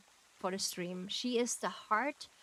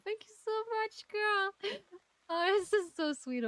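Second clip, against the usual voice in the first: much higher, fast squeal of thanks; excited thanks; 11 semitones higher than usual. Real, not acted. Thanking a viewer for support, high quick excited squeal. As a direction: gush it out, high and quick, into a laugh.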